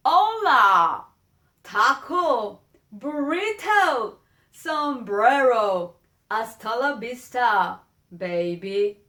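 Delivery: loud, hammed-up gringo Spanish with slow stretched words; mock a tourist shouting stock Spanish words. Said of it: "That is not trying to speak my language".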